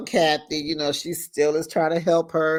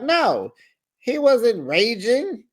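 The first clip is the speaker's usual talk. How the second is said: mock protest; 7 semitones higher, slower than usual. Dorit protesting to her friends.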